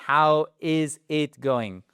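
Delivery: slow, deliberate teacher demo; slow it down so they can follow; slowed English question for learners. Shown as a demonstration. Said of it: "Vous voyez, on va très vite".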